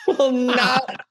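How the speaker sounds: high, held-out "not you"; mock indignant retort. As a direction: squeal it back in mock disbelief. Acted retort to the uncle.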